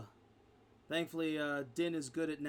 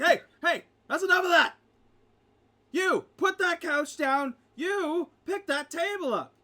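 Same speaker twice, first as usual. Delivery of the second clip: much higher and louder, barking orders; furious shout; 12 semitones higher, 11 dB louder than usual. Acted. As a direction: shut down the brawl in his tavern. Innkeeper stops the tavern brawl.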